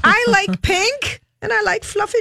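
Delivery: high-pitched mock little-girl voice. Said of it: "I want that little girl to talk".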